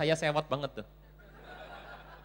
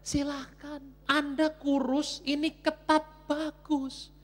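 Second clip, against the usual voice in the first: high-pitched, drawn-out granting; mock concession; 10 semitones higher, much slower than usual. Preacher granting tight clothes suit the thin, high comic protest.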